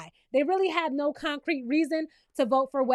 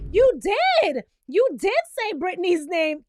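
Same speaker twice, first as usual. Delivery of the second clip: loud, higher mock accusation; insistent accusation; 4 semitones higher, 6 dB louder than usual. Carolyn catching Danielle in a lie, acted accusation. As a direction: hit DID hard, imitating an outraged accuser.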